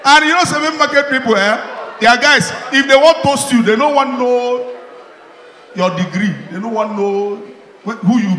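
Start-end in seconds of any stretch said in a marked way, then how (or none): 2.0-2.5 loud, high call to the crowd. Real, not acted. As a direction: grab the room's attention. Comedian calling to crowd.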